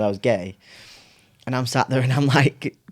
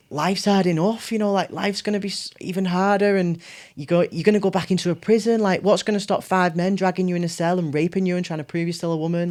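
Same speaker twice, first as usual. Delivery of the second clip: higher, pleading worried mum voice; 8 semitones higher than usual. Retold or imitated. Mum fearing for her child in prison, higher worried plea. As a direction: plead like a frightened mother. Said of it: "my mum was like".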